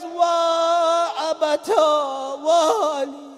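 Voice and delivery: very slow, high wailing cry; drawn-out keening for a father; crying lament. Acted. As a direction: wail it like a grieving daughter. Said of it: "Zainab cries".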